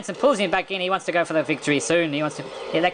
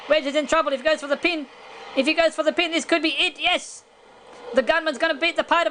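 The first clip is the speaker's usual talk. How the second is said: much higher-pitched, excited commentary; excited play-by-play; 9 semitones higher than usual. Real, not acted. Commentator calling a sudden turn in the match, excited. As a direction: call the big moves in a high, urgent pitch.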